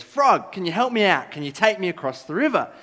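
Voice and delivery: high, loud call across to the frog; scorpion calling out. Acted. Scorpion calls the frog to ferry him, loud high hail. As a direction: hail the frog loudly, asking a favour.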